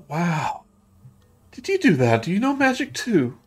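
high, wide-eyed wonder; amazed little girl. Acted. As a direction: marvel at it like an awestruck child. Rose amazed by sparkle magic.

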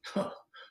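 halting forced laugh breaking into high breathless laughter; fake laugh turning real. Shown as a demonstration. Laughter coach showing the transformation, fake laugh becoming real. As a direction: start forced, then crack up for real. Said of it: "goes from that to real, like this. Watch".

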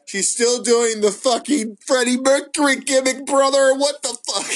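high-pitched disbelieving exclamation; exasperated and amused disbelief, pitched high; incredulous outburst. Real, not acted. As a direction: blurt it out, pitch way up, baffled. Mock outrage at the gimmick.

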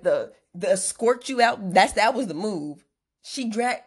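ordinary delivery

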